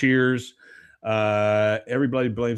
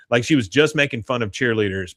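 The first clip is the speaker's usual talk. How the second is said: ordinary talk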